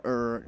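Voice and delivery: low, soft "er" growl; small stomach growl. Retold or imitated. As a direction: imitate a modest little belly rumble. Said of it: "it's not just, like, you know".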